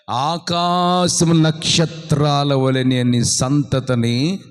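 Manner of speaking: slow, loud blessing; solemn proclamation. Acted. God promising Abraham descendants, slow and solemn. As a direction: pronounce the promise slowly.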